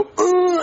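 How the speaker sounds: high constipated push noise; mock toilet-straining grunt. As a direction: imitate pushing hard on the toilet. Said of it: "I try to poop, I go".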